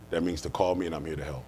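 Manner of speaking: ordinary delivery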